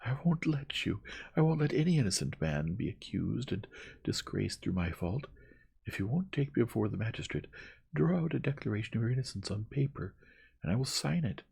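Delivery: high, impassioned, hurrying demands; hysterical vehemence. A narrator's reading. Young woman ordering her cousin to clear himself, hysterical. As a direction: insist wildly, rushing through the middle. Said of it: "it was the language of hysterics".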